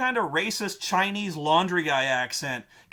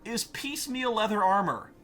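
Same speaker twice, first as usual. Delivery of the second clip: normal talking voice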